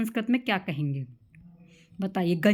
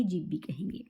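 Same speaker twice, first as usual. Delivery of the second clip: softer, whispered; whispered aside; 3 semitones lower, 8 dB quieter than usual. Acted. Teacher whispering the final synonym in a list. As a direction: let it drop to a whisper.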